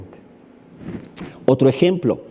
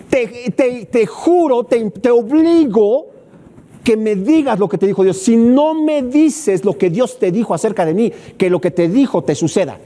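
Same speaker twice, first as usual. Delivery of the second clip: higher, louder stammering oath; stern threat; 6 semitones higher, 3 dB louder than usual. Acted. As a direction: swear it at him, forcing the answer. Eli threatening Samuel.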